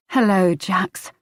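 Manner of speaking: slow, gentle hello, as to an invalid. A narrator's reading. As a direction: soothe her like a patient. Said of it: "Her tone was gentle".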